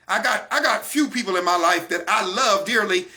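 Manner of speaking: usual speaking voice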